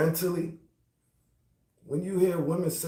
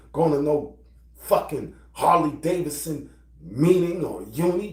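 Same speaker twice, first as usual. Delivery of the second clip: ordinary talk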